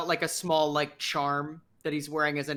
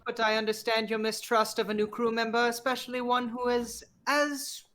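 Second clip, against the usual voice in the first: eccentric crew member; 7 semitones higher than usual. Acted. Plays an eccentric new crew member.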